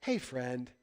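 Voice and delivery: low, soft, soothing greeting; peaceful, calming Jesus. Retold or imitated. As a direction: defuse a quarrel gently, voice dropped. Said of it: "calm Jesus trying to calm the situation down".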